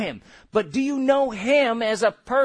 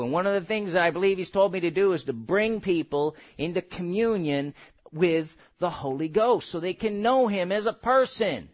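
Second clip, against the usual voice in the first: ordinary delivery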